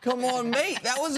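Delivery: high-pitched mock Australian accent; imitated Australian accent. Teasing a co-host's impression, high imitation Australian accent. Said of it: "abbreviated Australian accent".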